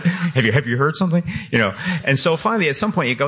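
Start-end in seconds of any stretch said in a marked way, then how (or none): 0.0-1.2 higher-pitched, halting acted question; mock wary question. Writer questioning his book in a retold exchange, mock wary. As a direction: ask it high, lightly play-acting suspicion. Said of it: "you're like".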